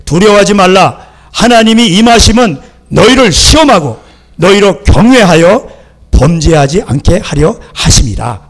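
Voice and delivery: loud, emphatic scripture reading; forceful preaching. Acted. Moses addressing the people, forceful proclamation. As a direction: proclaim the verse with full pulpit force.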